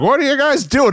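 high whiny mock of an old wrestler; old man whine. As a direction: squeak it out like a put-upon veteran. Old wrestler quoted in story.

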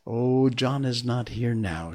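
ordinary delivery, nothing marked

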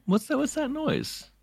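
high, hushed mock-startled question. Players hearing a noise, high startled question.

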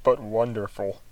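normal talking voice

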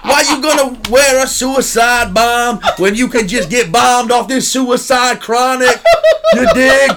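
high, loud put-on thug voice, drawn out; mock thug voice. Comedian inventing a Doc Raj joke, in a loud mock thug voice. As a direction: ham up a street comic's punchline slowly. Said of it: "Doc Raj in the house".

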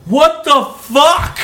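high, loud, hugely drawn-out disbelief; imitating shocked outburst. Crash victim to the driver who hit him, incredulous. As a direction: stretch every word into a mock yell.

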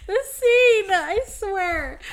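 high, loud wail over the scene; overwhelmed, close to tears; about to cry. Real, not acted. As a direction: wail it helplessly. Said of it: "It's gonna make me cry".

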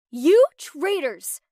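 hammed-up furious cry, short of a real scream. A narrator's reading. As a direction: draw out the outraged threat loudly. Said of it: "she screamed".

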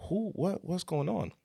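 high, puzzled call-out at a crowd; wide-eyed mock confusion; surprised who what. Baffled by crowd outside club.

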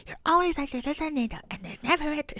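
high, soft mimicking sing-song; mocking. Mother imitates her worried daughter's tornado warnings, mocking. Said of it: "she's mocking me".

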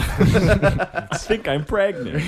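slow mock-serious between laughs; slow laughing joke. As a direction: drag it out while laughing.

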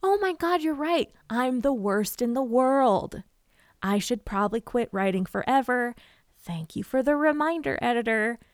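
hammed-up self-pitying outburst; mock despair. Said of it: "I might go".

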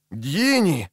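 usual speaking voice

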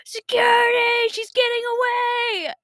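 high, loud, drawn-out yell for security; hammed-up slow-motion holler; imitated alarm shout. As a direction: mimic guards calling out in alarm. Imitating guards yelling for security.

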